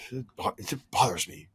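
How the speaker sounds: soft, deflated grumble; weary sigh. Real, not acted. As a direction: let the frustration sag out. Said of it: "I'm gonna try to calm myself".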